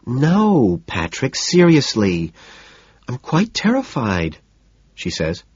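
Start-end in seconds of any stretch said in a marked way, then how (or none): 0.1-4.4 slow, higher whiny protest; mock terrified whine. A narrator's reading. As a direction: overplay being frightened. Evelyn claims fear, whining.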